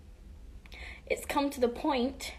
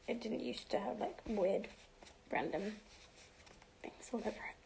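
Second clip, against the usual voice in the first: whispered; 11 dB quieter than usual. Real, not acted. Whispered aside.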